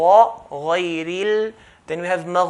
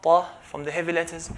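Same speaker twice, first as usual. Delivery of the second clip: normal talking voice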